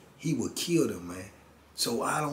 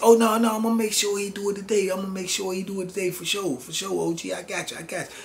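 frantic reassurance; 9 semitones higher than usual. Retold or imitated. Friend scrambles to reassure the OG, high and eager. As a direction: swear he'll get it done, pitched way up.

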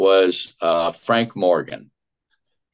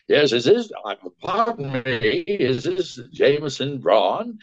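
higher-pitched, drawn-out dithering wizard voice; Frank Morgan; 4 semitones higher than usual. Retold or imitated. Actor phoning the ad producer in character, imitating the film wizard. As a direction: put on a fussy, hesitant old-film voice. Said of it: "This is Frank Morgan".

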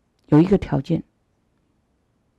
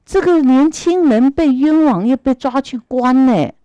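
higher and louder indignant argument; heated protest; 6 semitones higher, 5 dB louder than usual. Acted. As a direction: argue back hard for the wronged youth. Fake god protests to true god.

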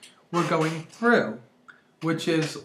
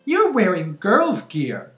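put-on slow, louder little-kid accusation; drawn-out, higher little-boy voice; 4 semitones higher, 6 dB louder, slower than usual. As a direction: imitate a small child pointing out the obvious. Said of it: "Cutest little boy in the world".

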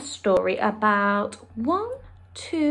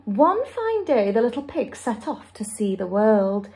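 usual speaking voice

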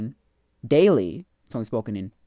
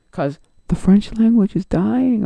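much higher, louder and slow put-on whine; mocking voice; 10 semitones higher, 8 dB louder, slower than usual. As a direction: parody the people who claim this. Mocking the claim he rejects.

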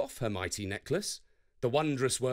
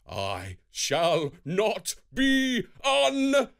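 slow, loud, higher Thor protest; thundering refusal; 7 semitones higher, 5 dB louder, much slower than usual. A narrator's reading. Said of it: "thundered Thor".

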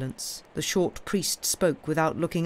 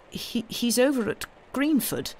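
higher, hesitant stammer; faltering; 7 semitones higher than usual. A narrator's reading. Curate nervously answering where the blacksmith is, faltering. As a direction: falter over the first word, voice raised in pitch. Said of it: "faltered the curate".

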